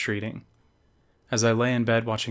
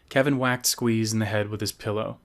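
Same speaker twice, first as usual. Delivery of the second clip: ordinary delivery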